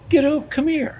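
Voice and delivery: high commanding call; forceful call. Retold or imitated. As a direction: order him over, bossy. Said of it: "I just told Ron Howard".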